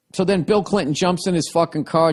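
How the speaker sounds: ordinary talk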